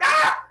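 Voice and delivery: high, loud shriek of shock; short acted yelp of fright; mock startled scream. Athena startled by what she sees.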